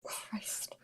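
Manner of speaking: breathy whispered curse. Real, not acted. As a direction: whisper it under your breath. Muttered reaction to the story.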